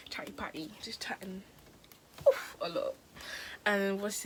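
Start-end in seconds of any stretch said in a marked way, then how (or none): 0.1-1.4 whispered aside; whispering. Real, not acted. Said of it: "whispered aside". 2.3-2.9 breathy whispered aside; whispering. Real, not acted. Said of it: "whispered aside".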